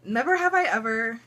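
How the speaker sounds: ordinary talk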